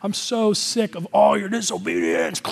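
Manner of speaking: hammed-up exasperated dad outburst; angry, overheating dad. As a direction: act out a dad boiling over. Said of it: "angry, overheating dad who's like".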